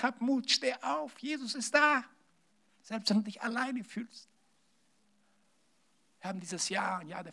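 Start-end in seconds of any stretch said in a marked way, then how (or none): 0.0-2.0 high, slow, comforting call; Jesus encouraging, slow and raised; voicing Jesus. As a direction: lift the voice and call out like Jesus lifting someone up. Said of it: "Er sagt".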